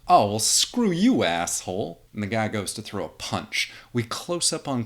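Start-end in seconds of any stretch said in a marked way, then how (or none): 0.1-2.0 loud, higher curse; angry outburst. Acted. Tough guy cursing at Clark, loud and higher. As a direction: lash out before the punch.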